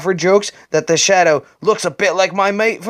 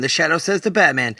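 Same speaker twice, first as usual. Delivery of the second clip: ordinary delivery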